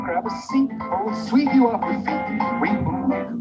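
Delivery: ordinary talk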